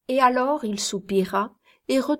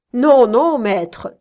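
cried out; 3 semitones higher, 8 dB louder, much slower than usual. A narrator's reading. Kit loudly protests to his master, crying out. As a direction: burst out in loud denial. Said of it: "s'écria Kit".

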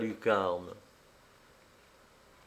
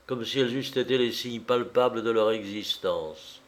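ordinary delivery, nothing marked

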